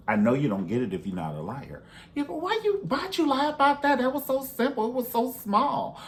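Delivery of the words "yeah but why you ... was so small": high mock disbelief; exasperated friend. Friend questioning a liar in a high mocking voice. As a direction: play the baffled friend, pitched way up.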